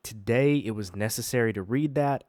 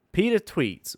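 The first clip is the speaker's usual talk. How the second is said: ordinary talk, nothing marked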